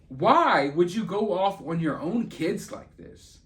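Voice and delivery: normal talking voice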